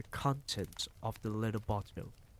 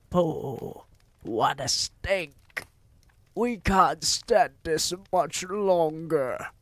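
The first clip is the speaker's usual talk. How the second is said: higher, louder, slow cries of disgust; disgusted witch cries; 7 semitones higher, 7 dB louder, slower than usual. A narrator's reading. Witches crying out at the boy's smell, loud and disgusted. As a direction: witches choking on the stink. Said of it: "there were cries of".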